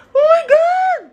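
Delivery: real high, loud shriek of delight; excited scream. Real, not acted. Reacting to her favorite fruit, a real delighted shriek. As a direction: let the thrill burst out.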